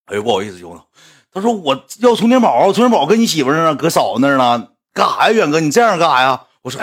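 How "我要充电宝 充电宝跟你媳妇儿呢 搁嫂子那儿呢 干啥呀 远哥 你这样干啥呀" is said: higher, louder fan complaining; annoyed fan. Retold or imitated. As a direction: demand the power bank back, indignant. 粉丝讨要充电宝. (粉丝高声不满地讨要)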